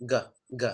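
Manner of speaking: "G" said as its sound, a short voiced "guh". Shown as a demonstration. Teacher demonstrating the G sound to students, "G" as a short "guh". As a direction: sound out "G" as "guh", not the letter name. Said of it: "The G sound".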